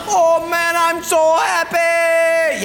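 high and loud, so happy slowly stretched out; blissful gush. Acted. The man with a garage of Harleys gushes to his giver, blissful. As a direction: melt into it, savouring every word. Acted rich gift recipient.

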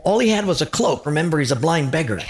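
ordinary talk

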